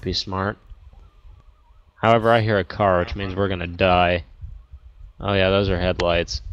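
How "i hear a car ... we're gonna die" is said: ordinary talk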